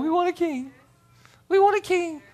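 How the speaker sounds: put-on high, childish clamoring for a king; mock crying out. Preacher imitating Israelites demanding a king, mock crying out. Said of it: "they're crying out".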